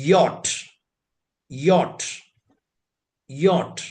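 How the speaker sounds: യോട്ട് said yot, with a y and a hard t; German letter name yot. Shown as a demonstration. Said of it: "നമ്മൾ ഉച്ചരിക്കുക".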